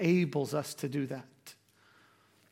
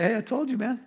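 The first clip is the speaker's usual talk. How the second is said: a touch of smug told-you-so, slightly higher; 4 semitones higher than usual. Retold or imitated. Parent to kids, mildly smug I-told-you-so. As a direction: lightly scold a kid who ignored you. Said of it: "I'm like".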